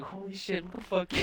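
high retold freak-out; heart-pounding panic. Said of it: "my heart's pumping".

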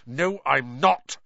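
slow, understated anger; angry. A narrator's reading. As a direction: push back firmly, a touch cross. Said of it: "said Harry angrily".

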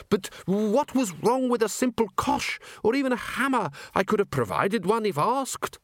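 prim, stammering museum curator; fussy curator voice. Acted. Curator complains about weapon.

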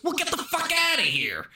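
high hammed-up tough guy; mock gangster yell. Retelling the movie, Ray Liotta's high mock gangster yell. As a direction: bark it like a cartoon gangster.

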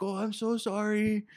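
high-pitched pleading apology; mock apology. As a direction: put on an over-sorry, high voice. Acting out an apology in a story.

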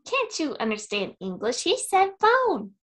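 put-on high, loud incredulous boy; high loud shocked child; Elliot impression. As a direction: squeak it out as a disbelieving kid. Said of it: "doing Henry Thomas so well".

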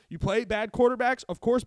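ordinary talk

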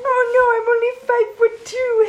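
very high, loud squeal of fake distress; hammed-up damsel crying for help; mock helpless wail. Host teasing short guest, mock-helpless high wail.

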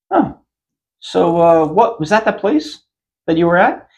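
doctor voice. Retold or imitated.

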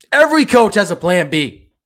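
loud, high-pitched outburst; exasperated shout. Pretend. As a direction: yell it at the coach in disbelief. Imagined shouting at the coach.

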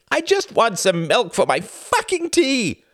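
high, slow exasperated outburst; mad frustration. A narrator's reading. Husband begs for milk for his tea, madly frustrated. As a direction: drag out each word in frustration. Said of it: "in mad frustration".

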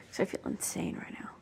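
breathless whispered fluster. Real, not acted. Vlogger flustered while searching her room, whispering.

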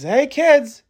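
put-on high-pitched call to the kids; acted parent's call, pitched high; yelling upstairs. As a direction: holler up the stairs to your children. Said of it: "Yell upstairs to your kids".

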